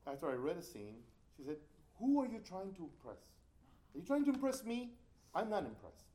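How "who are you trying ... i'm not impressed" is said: loud, high imitation of a scolding teacher; retold teacher's sharp telling-off; mock scolding. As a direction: challenge the student, raised voice.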